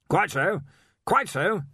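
slow, careful, grinning strain; through a fixed grin. Acted. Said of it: "slowly and carefully through his fixed grin".

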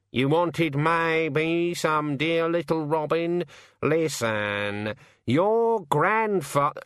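high, slow talking-bird voice; drawn-out raven voice. Acted. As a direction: stretch the words out like a wheedling raven. Raven talks on to Susan.

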